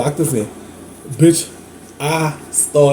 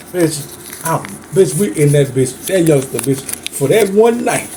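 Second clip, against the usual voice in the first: normal talking voice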